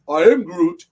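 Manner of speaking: loud, emphatic character catchphrase; Groot. Retold or imitated. Host quoting the character from a game cover, loud impression. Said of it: "That's what he would say".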